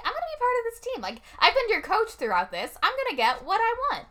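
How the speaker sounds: high, demanding mom voice; a hammed-up bossy mother claiming her place; pushy mom. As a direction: push your way onto the team like a bossy mom. Mom voiced in retold scene.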